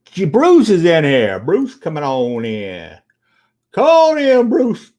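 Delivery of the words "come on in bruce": high, loud, cheery call-in; calling out a welcome. Real, not acted. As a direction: sing out a welcome to Bruce. Greeting a viewer by calling.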